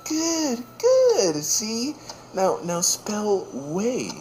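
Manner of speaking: high, sing-song praise; hammed-up proud mom; praising mom. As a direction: coo it like a mom cheering her kid.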